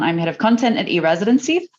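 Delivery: usual speaking voice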